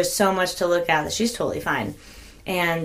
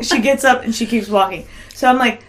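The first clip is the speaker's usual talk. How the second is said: usual speaking voice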